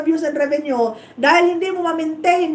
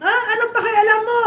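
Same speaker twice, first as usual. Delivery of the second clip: angry retort; 7 semitones higher, faster than usual. Real, not acted. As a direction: bite back at her, voice pitched way up. Snapping back at a rival vlogger.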